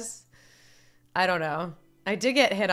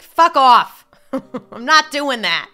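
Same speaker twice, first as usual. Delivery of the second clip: loud, higher-pitched comic New Yorker outburst; hammed-up, punchy New Yorker dismissal; mock New Yorker refusal; 5 semitones higher, 11 dB louder, slower than usual. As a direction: play a brash New Yorker telling them off, laughing midway. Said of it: "all the New Yorkers would just be like".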